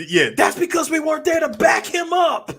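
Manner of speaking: high, loud heated comeback; retold shouted retort. Retelling a movie argument, shouted indignant comeback. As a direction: yell it back in mock outrage.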